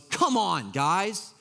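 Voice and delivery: high, exasperated scolding of youngsters; grumpy boomer. Retold or imitated. Jesus scolding his disciples like a grumpy boomer, exasperated. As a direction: complain like a boomer blaming the kids. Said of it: "like a boomer who's blaming millennials".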